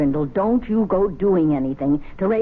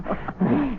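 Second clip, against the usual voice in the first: short laugh; 5 semitones higher, 3 dB quieter than usual. Acted. Wife laughs off his grumbling.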